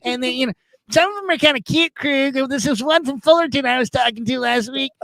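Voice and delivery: high-pitched, eager voicemail rambler; Ralph. Retold or imitated. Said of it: "it's Ralph again".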